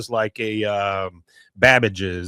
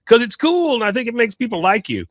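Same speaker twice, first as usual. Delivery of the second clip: put-on much higher kid voice, loud on the start; high Bobby voice; 11 semitones higher, 3 dB louder than usual. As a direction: play the chubby boy bragging. Bobby voiced in retold scene.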